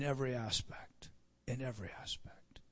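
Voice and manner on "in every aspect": unvoiced whisper. Real, not acted. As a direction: repeat it as a hushed whisper. Echoed as a whisper.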